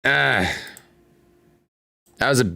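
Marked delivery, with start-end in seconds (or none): none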